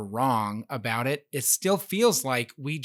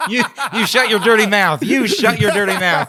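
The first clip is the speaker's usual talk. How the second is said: loud, higher, laughing mock scolding; mock outrage; 7 semitones higher, 10 dB louder than usual. Host teasing him back, mock offended and laughing loud. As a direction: shout it back with a laugh, playfully. Joking retort to a teasing question.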